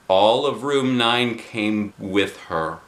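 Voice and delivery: plain reading voice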